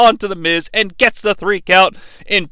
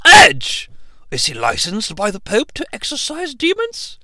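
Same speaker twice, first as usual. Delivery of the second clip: regal Pope voice. Pretend. Pope character asks back.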